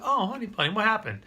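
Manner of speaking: gentle dad voice. Acted. Dad gently answers spilled snack.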